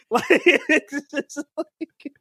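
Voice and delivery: teasing, creased up with laughter; breathless giggling joy; laughing. Real, not acted. Laughing about weave and bundles.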